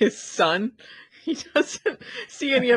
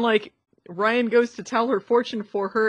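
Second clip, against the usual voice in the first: usual speaking voice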